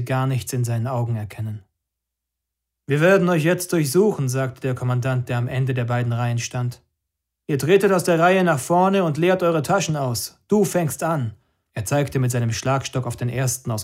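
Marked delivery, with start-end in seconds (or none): 2.8-4.3 higher, louder voice of a police commander; stern commander announcing a search; commander's order. Acted. Commander addresses detainees. 7.5-11.3 higher, louder commanding voice; commander's order. Acted.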